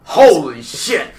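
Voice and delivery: loud, slow, drawn-out exclamation; imitated amazed reaction. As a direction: belt it out, stretching both words.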